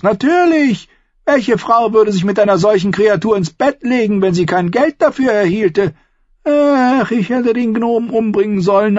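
high, loud, ranting Lysa; bitter aunt. A narrator's reading. Aunt Lysa rants to Sansa about Tyrion, high and heated. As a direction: rail against the dwarf, spiteful. Lysa's long tirade.

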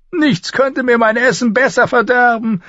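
high-pitched dry dwarf voice; dry retort. A narrator's reading. Dwarf turns down the singer's offer, dry and high. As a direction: turn him down drily, pitched high. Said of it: "entgegnete der Zwerg trocken".

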